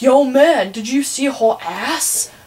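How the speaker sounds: a loud "Yo, man," then a slow, leering "did you see her ass?"; mock the loud study-hall bros; mocking crude teen boy. Freshman imitating loud boys in study hall, mocking bro voice.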